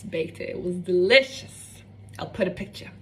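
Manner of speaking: normal talking voice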